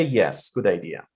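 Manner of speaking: low, level "Yes, good idea"; uninterested intonation. Shown as a demonstration. Teacher showing learners the contrast, uninterested intonation. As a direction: say YES and IDEA low, without any lift. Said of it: "You see the difference?".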